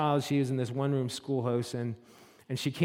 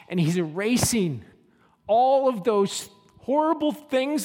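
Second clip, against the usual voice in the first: soaring, much higher and louder impassioned preaching; preaching at full force; 5 semitones higher, 6 dB louder than usual. Acted. Pastor calling Margaret to her new identity, impassioned preaching. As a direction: build it like a revival climax.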